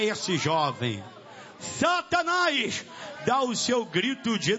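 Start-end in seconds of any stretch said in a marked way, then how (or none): 1.6-2.8 high, drawn-out call to the devil; confronting cry. Real, not acted. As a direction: cry the name out slowly, pitched high. Calling out Satan by name. (brado agudo, confrontando o diabo)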